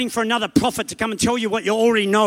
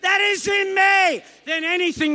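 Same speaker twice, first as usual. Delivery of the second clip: slow, high shouted declaration; mean it; 9 semitones higher, slower than usual. Shown as a demonstration. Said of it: "Say it like you mean it".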